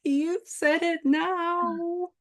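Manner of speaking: drawn-out teasing, you're caught now. Pretend. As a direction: stretch it out to rub it in. Teasing after slip.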